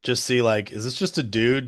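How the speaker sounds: usual speaking voice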